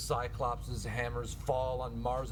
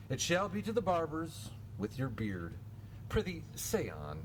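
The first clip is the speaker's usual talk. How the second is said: usual speaking voice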